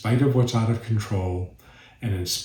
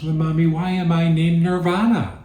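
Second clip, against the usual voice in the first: put-on higher, childlike questioning voice; child voice; 8 semitones higher, 4 dB louder than usual. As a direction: play the curious little kid asking mom. Second child asking in joke.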